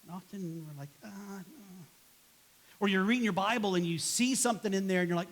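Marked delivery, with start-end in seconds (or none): 1.0-1.9 very soft, mumbled reluctance; hushed, half-hearted brush-off; reluctant refusal. Acted. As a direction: back out quietly. Acted reluctant refusal to Jesus.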